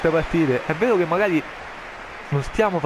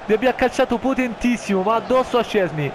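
excited goal chance call; 8 semitones higher than usual. Acted. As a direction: shout the chance, then ease down.

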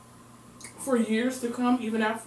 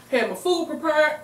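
ordinary talk, nothing marked

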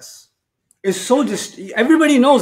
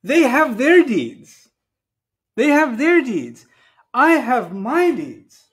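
slow, raised pitch, weighting THEY and I; emphatic stress on whose deeds; 5 semitones higher, slower than usual. Real, not acted. Preacher separating their deeds from his own, emphatic. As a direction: set they and I apart, slowly and firmly.

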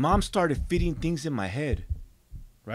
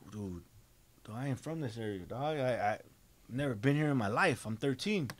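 low, soft, bewildered retelling; lost and stunned; 4 semitones lower, 7 dB quieter than usual. Retold or imitated. Thirteen-year-old stranded in an unknown area, soft and stunned. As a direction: say it quietly, as if thinking aloud.

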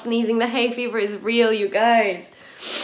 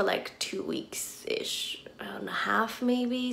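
whispering; 11 dB quieter, much slower than usual. Real, not acted. Sheepish aside about overbuying food.

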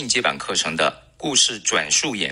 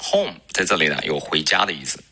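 normal talking voice

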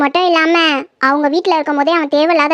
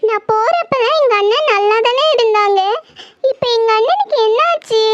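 tearful distress; 5 semitones higher than usual. Acted. Sister cries over dead brother.

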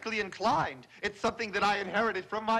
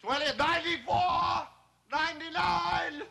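shouted count; 7 semitones higher than usual. Acted. Exhausted counting blurted out.

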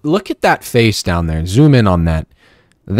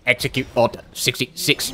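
commanding villain quote; 8 dB quieter than usual. Pretend. Gamer ordering an attack on King Arthur, mock-sinister. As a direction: give the order softly, word by word.

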